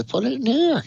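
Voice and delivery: very high, soft invitation; mock old lady. Old lady lifting her skirt for the lemons, high mock invitation. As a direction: pitch it way up as a coy imitation.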